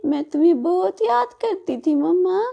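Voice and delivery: put-on high, loud joyful little girl; overjoyed child. Daughter hugs bulbul thinking it's mama, high and joyful. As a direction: cry out mama with happy relief.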